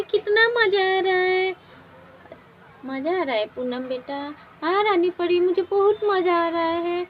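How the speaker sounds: put-on loud, high delighted girl; a girl overjoyed to fly; thrilled child. Poonam flies with the fairy queen, thrilled.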